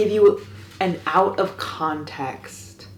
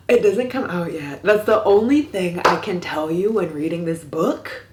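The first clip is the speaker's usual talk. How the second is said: ordinary delivery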